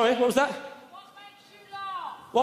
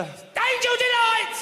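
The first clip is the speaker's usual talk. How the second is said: high, drawn-out vendor's call; long high sing-out to the crowd; confident usher; 8 semitones higher, much slower than usual. Retold or imitated. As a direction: call it out slowly like a theatre usher selling puddings. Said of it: "It's a very confident usher".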